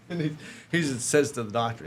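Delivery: ordinary delivery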